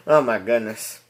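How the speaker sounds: ordinary talk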